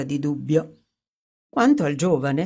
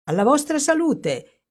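a bright toast, much higher in pitch; exclaimed toast; 10 semitones higher than usual. Acted. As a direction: raise the glass with forced cheer. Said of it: "esclamò vuotando il bicchiere".

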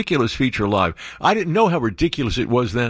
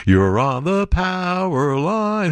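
slow, drawn-out radio announcer voice; 7 semitones higher, much slower than usual. Retold or imitated. Host imitating his old Sunday radio intro, slowly. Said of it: "Brother John Rivers and the power line".

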